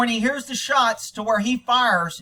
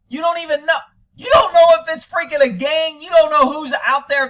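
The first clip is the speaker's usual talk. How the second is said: loud, high-pitched incredulous rant; real heated scolding, raised voice; angry outburst; 8 semitones higher, 7 dB louder than usual. Real, not acted. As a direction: burst out in exasperated disbelief. Outburst at sheep inside the door.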